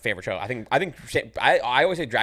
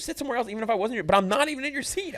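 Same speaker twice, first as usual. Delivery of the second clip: much higher-pitched indignant retold rant; mock outraged retort; 12 semitones higher than usual. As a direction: squeak it up high in disbelief at her. Retelling telling off theater lady.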